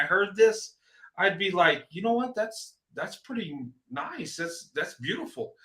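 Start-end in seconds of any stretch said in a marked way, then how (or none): 1.9-5.5 quietly impressed. Pretend. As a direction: murmur it softly as if struck by what you heard. Imagines a stranger's admiring reaction.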